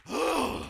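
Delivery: big breathy sigh of release; heavy sigh. Shown as a demonstration. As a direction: sigh it out at the trouble. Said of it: "you not groaning or sighing".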